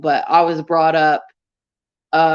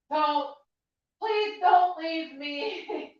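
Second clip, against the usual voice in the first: begging an ex back; 8 semitones higher, 10 dB quieter, much slower than usual. Pretend. Mock pleading to viewers.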